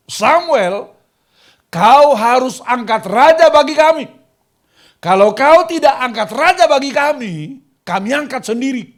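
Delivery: a crowd demanding their king; furious chant of protesters; angry protest demand. Retold or imitated. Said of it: "Orang Israel itu demo nasional".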